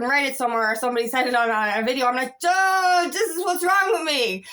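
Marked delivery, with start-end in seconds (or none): 2.4-4.4 high, loud burst of recognition; excited realization. Retold or imitated. Speaker reacts to a video explaining her state, excited realization. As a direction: exclaim it like a sudden revelation. Acting out the moment she found out.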